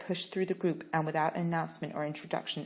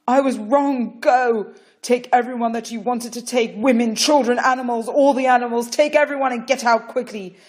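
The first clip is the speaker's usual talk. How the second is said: beg, near tears; desperate plea; 7 semitones higher, 14 dB louder than usual. Acted. Pharaoh begging Moses to leave, desperate loud plea.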